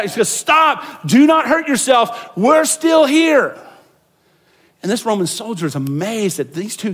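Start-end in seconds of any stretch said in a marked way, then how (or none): 0.3-3.6 high, loud urgent cry, slow at the end; shouting. Retold or imitated. Paul shouting to the guard about to kill himself. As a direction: stop him before he falls on his sword. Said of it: "Paul shouts out".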